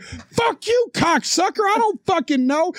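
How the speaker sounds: defensive, high-pitched cursing back; retold angry comeback; sharing their energy. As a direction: fire back in a mock outraged yell. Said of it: "I instantly shared their energy".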